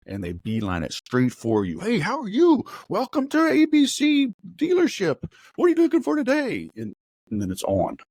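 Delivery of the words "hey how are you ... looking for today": much higher and louder, eager salesman greeting; pushy car salesman. Retold or imitated. Car salesman greeting an arriving customer, high and loud. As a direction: beam it out like a dealership salesman pouncing.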